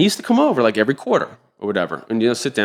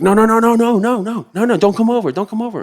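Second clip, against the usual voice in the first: mock panicked pleading; 12 semitones higher than usual. The adviser panicking on the phone.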